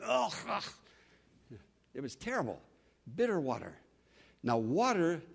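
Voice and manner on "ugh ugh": raised "ugh" of revulsion; disgusted retching noise. Acted. People tasting bitter water, disgusted groan. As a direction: spit out a foul taste. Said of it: "They tasted it".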